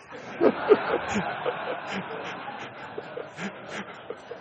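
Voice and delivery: a real silent wheezing laugh; breathy laugh at own joke. Real, not acted. Preacher laughing at his own parrot joke, wheezing laugh. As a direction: laugh at your own punchline, barely voicing it.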